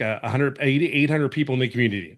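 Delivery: normal talking voice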